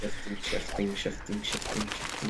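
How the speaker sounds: hushed, fading repeated murmur; bad impression. Player doing a bad impression while sneaking, hushed and fading. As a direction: repeat it under your breath, trailing into whisper. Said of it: "That was a really bad impression".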